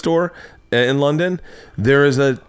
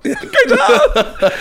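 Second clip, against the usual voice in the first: high, loud squeal through laughter; laughing mock praise; 5 dB louder than usual. Mimics begrudging backstage praise, laughing.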